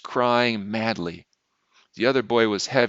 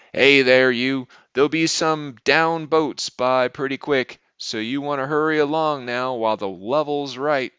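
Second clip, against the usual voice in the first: put-on raised, higher old lock-keeper's voice; thin, cracking hail; 4 semitones higher, 5 dB louder than usual. A narrator's reading. Lock-keeper hurrying the boatmen through, thin cracking call. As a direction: call out loudly, then urge them along. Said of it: "The hail came in a thin, cracking voice".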